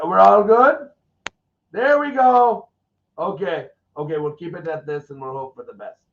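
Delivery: ordinary talk, nothing marked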